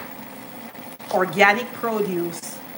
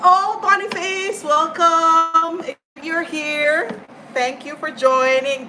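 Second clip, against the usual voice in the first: excited welcome; 8 semitones higher, slower than usual. Real, not acted. Greeting a viewer on live.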